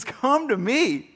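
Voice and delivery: a preacher voicing Jesus, beckoning warmly but a little lightly; calling invitation.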